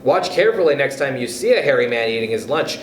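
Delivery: ordinary delivery, nothing marked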